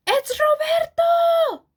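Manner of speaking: slow, very high excited shout. Acted. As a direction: cry it out in joyful discovery. Bugs recognise the hero.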